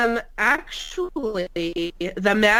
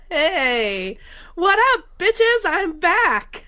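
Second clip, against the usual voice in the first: excited; 9 semitones higher, much slower than usual. Real, not acted. Excited greeting on return.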